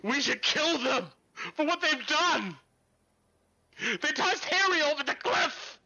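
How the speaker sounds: high, loud furious shout; angry villager. Acted. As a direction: yell for revenge at the paladins. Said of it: "This guy here goes".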